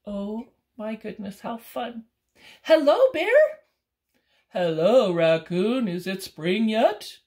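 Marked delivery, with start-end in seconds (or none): 2.6-3.6 very loud, high hello; loud raccoon call. Acted. Raccoon calling loudly to wake bear. 4.5-7.2 loud, slow bear; a big bear slowly waking. Acted. Bear asking raccoon about spring, loud and slow.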